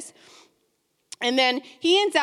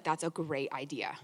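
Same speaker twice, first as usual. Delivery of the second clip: sly agreement; 10 semitones lower, 11 dB quieter than usual. Acted. Haman agreeing to gallows.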